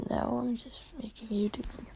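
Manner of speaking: fast unvoiced whisper. Real, not acted. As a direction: whisper it quickly to the viewers. Aside whispered to viewers.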